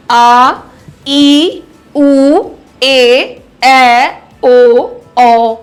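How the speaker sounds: each of आ ई ऊ ए ऐ ओ औ drawn out loudly; teacher's loud, lengthened vowel recital; long vowels, held. Shown as a demonstration. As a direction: hold every long vowel, one at a time. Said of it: "अधिक समय या दुगना समय लगता है".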